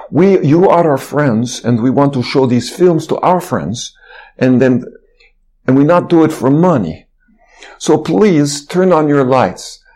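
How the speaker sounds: imitated Russian-accented plea. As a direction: plead politely in a Russian accent. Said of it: "speech in his Russian accent".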